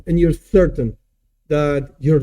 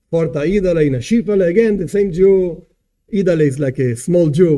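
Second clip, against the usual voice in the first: ordinary delivery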